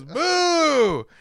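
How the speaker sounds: high, drawn-out boo at ordinary volume; imitated villain cry; mock boo. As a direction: send the boo way up and stretch it.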